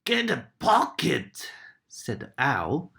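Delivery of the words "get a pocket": a hint of a gruff owl, higher; lightly voiced dozy owl. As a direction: toss it off like a sleepy owl. Owl character answers.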